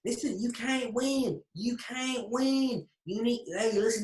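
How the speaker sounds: drawn-out, high-pitched Southern US taunt; high-pitched Southern accent. Retold or imitated. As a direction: stretch it out high in a gloating Southern drawl. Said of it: "high-pitched southern fucking accent".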